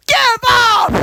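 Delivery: loud, very high scream; high-pitched furious shriek; infuriated yell. Acted. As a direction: scream it in full exasperation. Infuriated villain yells in story.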